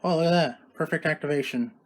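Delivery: ordinary talk, nothing marked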